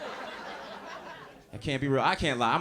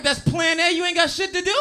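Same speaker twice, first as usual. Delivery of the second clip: incredulous; 11 semitones higher, 3 dB louder than usual. Retold or imitated. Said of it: "I'm like".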